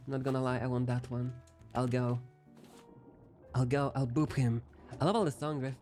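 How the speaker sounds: ordinary talk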